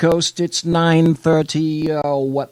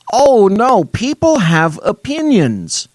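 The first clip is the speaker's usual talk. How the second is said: sarcastic fake horror, higher and louder; mock alarm; 8 semitones higher, 3 dB louder than usual. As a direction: gasp in pretend shock.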